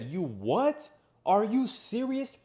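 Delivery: incredulous questioning, climbing high and loud; retold furious disbelief. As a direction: act out being appalled by a friend. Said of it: "I was furious. I was livid".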